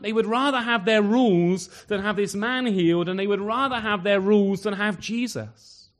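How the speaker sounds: preacher thundering that Jesus gets angry; preaching at full force. Acted. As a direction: hammer it home to the congregation.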